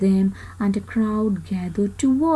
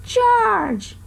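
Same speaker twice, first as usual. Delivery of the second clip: much higher call of the name; mild calling out, pitched up; 11 semitones higher than usual. A narrator's reading. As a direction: call George from across the crowd. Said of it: "heard someone call".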